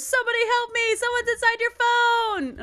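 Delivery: high, urgent cry for help; imitated panicked shout; mock ringtone scream. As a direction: yell it like a blaring novelty ringtone. Imitating a blaring novelty ringtone.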